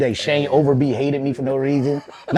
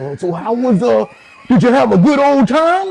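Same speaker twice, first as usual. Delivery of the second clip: imitating family back home; 10 semitones higher, 5 dB louder than usual. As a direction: ham up the folksy relatives' voice. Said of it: "that's how they fucking sounded to me".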